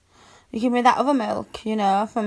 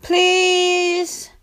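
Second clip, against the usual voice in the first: higher, whiny drawn-out please; begging; 5 semitones higher than usual. Acted. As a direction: whine it like a begging kitten. Cat girl begging.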